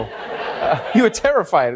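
normal talking voice

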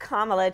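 "Kamala" as comma-la; comma plus la. Shown as a demonstration. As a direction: say "Kamala" like the word comma with la added. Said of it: "Just think of a comma and then add a la".

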